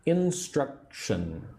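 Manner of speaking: slow, syllable-by-syllable "Instruction"; teacher breaking "Instruction" into three pieces; in three parts. Shown as a demonstration. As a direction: say "Instruction" in three drawn-out parts, in-struc-tion. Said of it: "Three parts".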